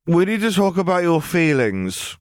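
high, level and unbothered; bored girl voice. A narrator's reading. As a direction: cut in as if nothing matters. Ellie's deadpan line.

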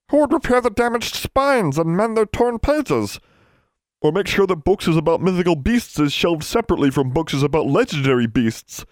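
high, fretting elf voice; distressed elf. A narrator's reading. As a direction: lament it high, easing down toward the end. Elves lament leaving their books.

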